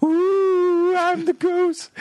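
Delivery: very high, slow wailing ghost; kid's ghost voice.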